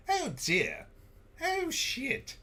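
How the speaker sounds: very high mock British voice; British accent. Host trying out what Brits say, hammed-up British accent. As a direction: put on a squeaky, prim British accent. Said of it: "for the Brits to say".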